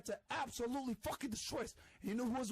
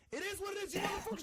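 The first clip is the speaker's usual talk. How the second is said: angry shout; 8 semitones higher than usual. Real, not acted. Host ranting in clip.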